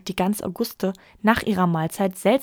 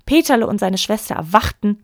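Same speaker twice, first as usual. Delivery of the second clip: plain reading voice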